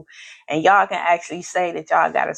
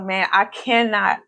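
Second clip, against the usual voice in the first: normal talking voice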